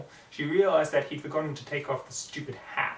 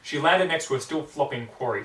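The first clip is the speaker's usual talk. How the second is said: ordinary delivery, nothing marked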